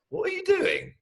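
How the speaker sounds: put-on high small-boy question; little boy voice. Little brother asking his sulky big brother, high childish voice. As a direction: pipe up like a curious kid.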